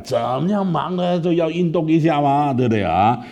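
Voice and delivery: put-on pastor coaxes the boy to play again; coaxing pastor. Pastor coaxing him back. (牧师温和地劝说)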